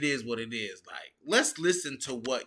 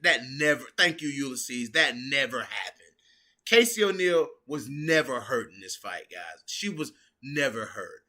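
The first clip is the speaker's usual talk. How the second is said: normal talking voice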